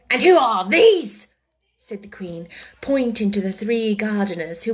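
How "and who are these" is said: very loud, raised-pitch royal question; imperious demand. A narrator's reading. The Queen asks about three gardeners, loud and imperious. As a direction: demand it at full voice.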